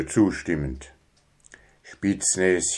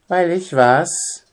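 much higher, slow pressing question; probing; 9 semitones higher, slower than usual. Acted. Son presses father, probing. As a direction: dig for the answer. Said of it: "bohrte Grabi weiter".

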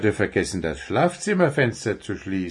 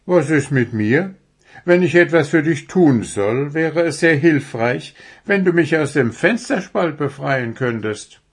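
higher, louder owl complaint; indignant owl character; 6 semitones higher, 5 dB louder than usual. Acted. Owl stuck in the window asks for rescue, indignantly. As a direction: play a stuck, put-upon owl.

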